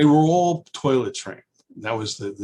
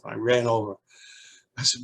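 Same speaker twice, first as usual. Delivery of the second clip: ordinary talk, nothing marked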